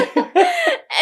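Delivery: high delighted laugh. Real, not acted. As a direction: laugh freely. Guest laughs.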